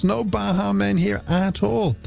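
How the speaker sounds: usual speaking voice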